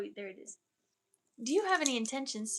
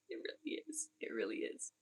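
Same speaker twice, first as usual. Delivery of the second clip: whisper; 6 semitones higher, 9 dB quieter than usual. Real, not acted. Whispered afterthought.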